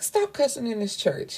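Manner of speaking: high-pitched retold telling-off; mock scolding. Woman chiding her man for cussing in church, high scolding.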